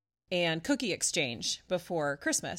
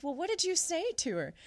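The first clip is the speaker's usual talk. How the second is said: lightly voiced, higher, curious friend; 9 semitones higher than usual. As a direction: ask it with a higher, eager lilt. Friend quoted asking.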